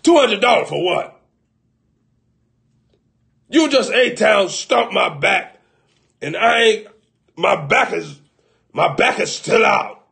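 loud, high indignant customer; angry complaint. Acted. Customer shouting at the chiropractors, loud and outraged. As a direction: yell about the bill, outraged.

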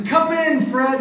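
high, bright invitation; high cheery welcome. Retold or imitated. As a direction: invite him in, sing-song. Host greeting Fred.

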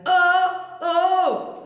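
high, loud distorted "oh"; dark modern metal cries; reduced overdrive, pushed high; darkened distortion. Shown as a demonstration. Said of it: "I'd take off the pitch".

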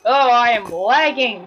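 loud, stretched-out complaint; exasperated shout. Real, not acted. Player hit by lag, exasperated yell. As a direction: cry it out slowly and loudly. Game lags mid-action.

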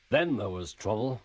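usual speaking voice

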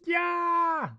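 high, loud panicked wail; Nami. Acted. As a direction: shriek in mock terror. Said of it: "Now Nami goes".

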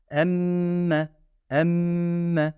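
Emme with the mm held long and nasal. Shown as a demonstration. Teacher shows students the held nasal in Emme. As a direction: hold the mm in Emme before releasing. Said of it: "Bakın tutuyorum".

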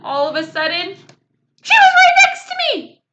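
building suspense breaking into a cry; excited. A narrator's reading. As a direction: burst out as she arrives. Dramatic climax of approach.

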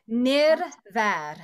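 Nirvad in two long, separate syllables; slow practice demo. Shown as a demonstration. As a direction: model Nirvad slowly for students to echo. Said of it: "practice saying Nirvad".

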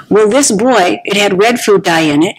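ordinary talk, nothing marked

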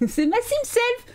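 high, slow laughing squeal at her own Sim; laughing in disbelief at her own Sim; laughing surprise. Real, not acted. Streamer spots her own Sim outside, squealing with laughter.

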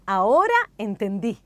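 bright, smiling relief. Shown as a demonstration. Teacher showing learners how to say it, smiling. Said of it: "una luminosa sonrisa al decir".

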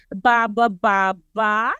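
"Ba, ba, ba, ba" with a rising question lilt; questioning ba-ba-ba-ba chant; Filipino ba inflection. Shown as a demonstration. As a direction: lead the audience in "Ba, ba, ba". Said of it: "If you can actually join me, say".

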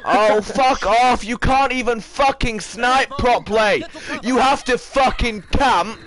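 real gamer rage; angry rant. Real, not acted. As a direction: lay into the camper angrily. Raging at a camping player.

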